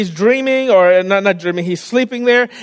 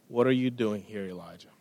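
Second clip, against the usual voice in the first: hushed, low and much softer; soft voice of God; 11 semitones lower, 15 dB quieter, faster than usual. A narrator's reading. God asking Elijah in the cave, very soft and low. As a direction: speak it gently, almost breathing it. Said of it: "a voice came to him and said".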